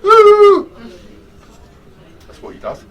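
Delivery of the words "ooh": a sudden high, loud squeal of surprise; startled ooh. Retold or imitated. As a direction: yelp "Ooh!" like a jump scare. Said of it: "And he goes".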